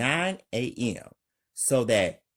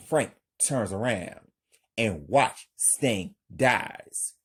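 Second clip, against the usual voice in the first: normal talking voice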